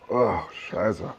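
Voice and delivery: ordinary talk, nothing marked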